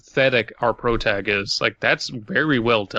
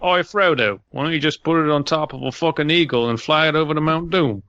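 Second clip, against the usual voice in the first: put-on Australian accent; 3 semitones higher than usual. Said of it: "I can't do an Australian accent correctly".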